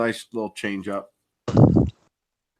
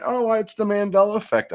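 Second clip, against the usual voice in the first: mock girl voice; 11 semitones higher, 4 dB louder than usual. Imitating the girl's remark.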